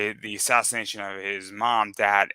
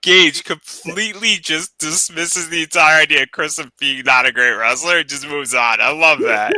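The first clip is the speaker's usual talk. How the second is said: laughing; 6 semitones higher, 8 dB louder than usual. Real, not acted. Amused jab at Gage.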